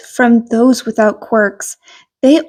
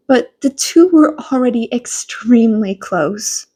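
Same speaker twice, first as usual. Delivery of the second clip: plain reading voice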